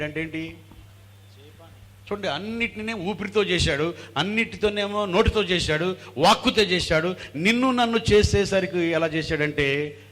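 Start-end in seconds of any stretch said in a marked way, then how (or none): 2.1-10.0 high, loud, driving sermon; impassioned pulpit proclamation; full-force preaching. Real, not acted. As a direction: pile up the phrases with rising fervour.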